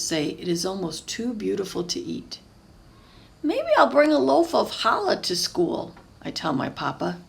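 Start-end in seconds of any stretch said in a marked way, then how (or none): 3.4-5.9 put-on higher, louder hopeful kid voice; a child floating an idea; eager boy. Pablo telling Papa his school plan, hopefully.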